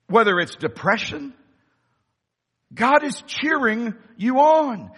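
usual speaking voice

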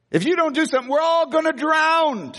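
high mock panic; panicked demand. Disciples laying it on Jesus, high mock panic. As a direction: plead it like the boat is going under. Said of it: "they laid it on him".

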